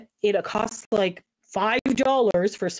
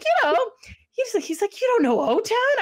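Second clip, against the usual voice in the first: mock disbelief; 13 semitones higher than usual.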